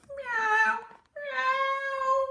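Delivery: very high thin kitten meow; kitten mewing. Acted. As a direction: mew like a scared little kitten. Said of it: "mewed George".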